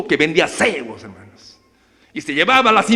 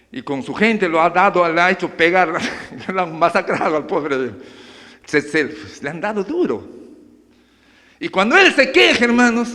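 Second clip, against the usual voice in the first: ordinary talk, nothing marked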